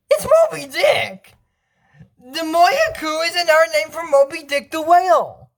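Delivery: ordinary talk, nothing marked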